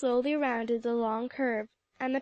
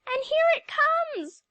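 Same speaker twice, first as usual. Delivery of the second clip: much higher, louder panicked shout; scream; 6 dB louder, slower than usual. A narrator's reading. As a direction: shriek as the train arrives. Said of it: "Lucy Bennett screamed".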